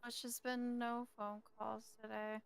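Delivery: ordinary talk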